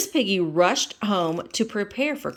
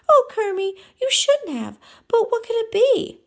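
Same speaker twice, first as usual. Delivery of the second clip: excited Miss Piggy voice; 7 dB louder than usual. Acted.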